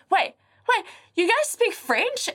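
high-pitched mock astonishment; mimicked surprise. Rugby player realising they understood him, acted-out surprise. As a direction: imitate someone caught out and startled. Retelling man's startled realisation.

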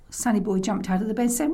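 ordinary delivery

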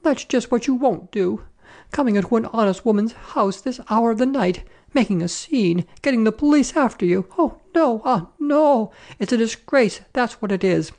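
high-pitched scolding tirade; outraged landlady in full flow; crying out, indignant. A narrator's reading. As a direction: scold them in a high, scandalised voice. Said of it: "she cried".

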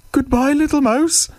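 high, hurried-off goodbye squeak; nervous snake farewell. Acted. Snake flees from the Gruffalo, high nervous voice.

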